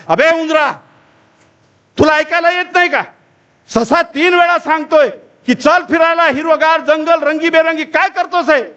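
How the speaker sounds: high-pitched, hammed-up indignant telling-off; snarling scolding. Three wolves snapping at the mouse for ignoring rabbit, indignant scolding. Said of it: "तिन्ही लांडगे खेकसतात".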